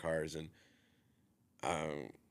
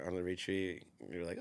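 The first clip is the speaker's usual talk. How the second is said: normal talking voice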